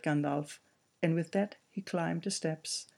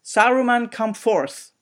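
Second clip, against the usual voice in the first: loud, higher call to come out; commanding shout; 6 semitones higher, 12 dB louder than usual. Acted. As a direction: order him out with authority. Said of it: "loud, commanding voice".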